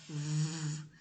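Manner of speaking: V buzzed low in the throat; voiced V demo. Shown as a demonstration. Teacher lets pupils feel the vibration on V. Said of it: "Vamos falar o V agora".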